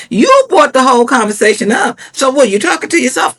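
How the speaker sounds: ordinary talk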